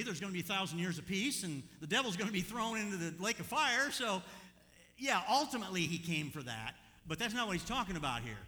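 loud, emphatic preacher explanation; forceful preaching. Real, not acted. Preacher answering a supposed contradiction, forceful preaching. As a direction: press the point home loudly.

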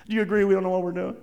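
ordinary delivery

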